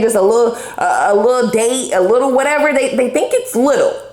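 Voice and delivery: mocking emphasis on "little"; stress on little. Shown as a demonstration. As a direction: lean hard on "little" each time. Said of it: "put a very deep emphasis on the, the word little".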